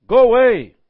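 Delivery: loud, high shriek of a demon; demon's cry. Acted. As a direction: cry out at the top of your voice. Demon cries out in story.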